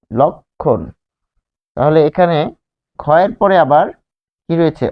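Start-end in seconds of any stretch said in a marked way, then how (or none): 0.1-0.8 "লক্ষণ" drawn out as lok... khon; rounded lo, split. Shown as a demonstration. Teacher models the word twice, "লক্ষণ" split as lok... khon. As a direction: break "লক্ষণ" in two slow halves, lok and khon. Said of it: "ফলে আমরা পাচ্ছি".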